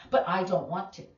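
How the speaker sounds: hammed-up pouty refusal; whiny student. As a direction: imitate a sulky kid refusing. Student whining to teacher.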